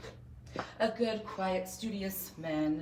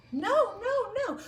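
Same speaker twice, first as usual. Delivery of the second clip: put-on high panicked child; scared squeal; 4 dB louder, slower than usual. A frightened child squeals denial at the teacher. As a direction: squeak it out in fear.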